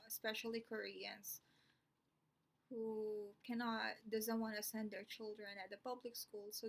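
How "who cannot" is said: usual speaking voice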